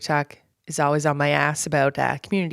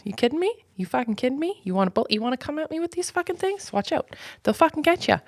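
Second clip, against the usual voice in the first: mock threat; 8 semitones higher, 3 dB quieter than usual. Mock-threatening commenters about her eyebrows.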